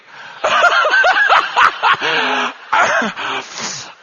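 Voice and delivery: big hooting laugh at his own bluff; loud high laugh. Real, not acted. Preacher laughs at how he bluffed at dinner, loud high laugh.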